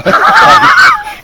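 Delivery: high loud burst of laughter. Real, not acted. Man laughing at his friend's joke about burning the stool, loud high laugh. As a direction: crack up loudly.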